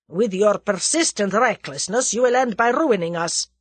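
loud, bitter Russian man; bitter reproach, pushed out loud; bitter exclamation. A narrator's reading. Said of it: "the latter exclaimed bitterly".

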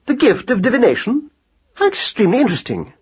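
high, fascinated lecturing; pedantic scholar mouse. A narrator's reading. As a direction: launch eagerly into a learned aside. Voices Marcus Aurelius.

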